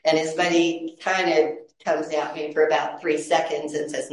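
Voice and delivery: normal talking voice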